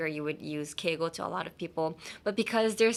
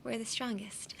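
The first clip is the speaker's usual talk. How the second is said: hushed, near-whispered casual line; quiet, understated and offhand; Geto Suguru; 2 semitones higher, 11 dB quieter than usual. Acted. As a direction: say it softly, with no emphasis. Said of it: "Geto Suguru was like".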